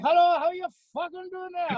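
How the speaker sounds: very high, hammed-up Welsh greeting; mock Welsh accent. Max phoning out of the blue, mock Welsh accent. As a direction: sing out a fake Welsh hello. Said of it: "And that Wel".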